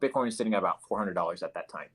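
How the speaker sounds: ordinary talk, nothing marked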